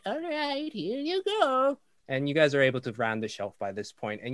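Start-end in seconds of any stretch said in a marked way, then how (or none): 0.0-1.8 put-on very old woman. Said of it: "And she's like".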